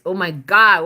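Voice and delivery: ordinary delivery, nothing marked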